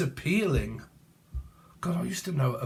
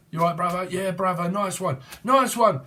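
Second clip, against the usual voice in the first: higher London geezer banter; London accent; 5 semitones higher, 3 dB louder than usual. Retold or imitated. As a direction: swap "nice one" like London mates. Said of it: "this could be London".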